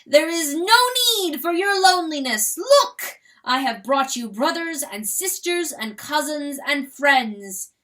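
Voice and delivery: high ringing grand voice; crowing. Acted. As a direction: proclaim it like a proud rooster. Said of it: "he crowed to the bird".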